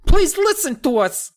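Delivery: tiny gnome begging to be heard; high gnome plea. Acted. Hap begging the kids to listen, very high voice. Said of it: "Hap rasped".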